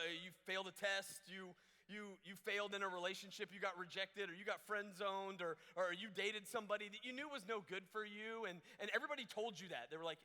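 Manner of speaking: usual speaking voice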